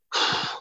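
real weary sigh; heavy sigh. Real, not acted. Storyteller pausing over painful memory, real sigh. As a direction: breathe out the pain. Pause before apologizing.

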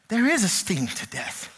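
normal talking voice

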